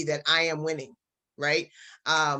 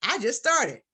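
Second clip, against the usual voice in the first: higher, protesting voice of a doubting newbie; doubtful student; 7 semitones higher than usual. Pretend. Student objecting to the coach's affirmations, protesting. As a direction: push back like an unsure beginner. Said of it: "Now, you may be saying".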